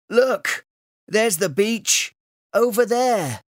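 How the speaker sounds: excited shout. A narrator's reading. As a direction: yell to your mates from the boat. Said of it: "shouted one of the men".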